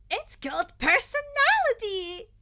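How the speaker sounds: high, loud proud chirp; beaming. Acted. Mina striking a pose, high and beaming. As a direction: shine with cheeky pride. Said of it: "Mina beamed".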